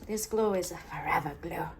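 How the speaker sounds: hushed, conspiratorial whisper. Pretend. Crafter confiding in viewers about her glue, whispered. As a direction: whisper it like a secret.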